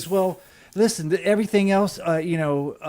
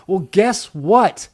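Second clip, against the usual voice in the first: angry rant; 5 semitones higher, 6 dB louder, slower than usual. Real, not acted. Angry rant about player.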